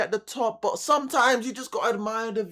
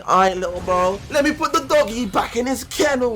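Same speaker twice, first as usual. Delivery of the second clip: higher, louder mock-casual boast; cocky Saitama; 3 semitones higher, 5 dB louder than usual. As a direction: toss it off like a bored hero. Saitama humoring his sidekick.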